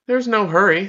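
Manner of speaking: put-on sleepy boy yawns his answer; yawning. A narrator's reading. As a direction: stretch the words out in a yawn. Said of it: "with a huge yawn".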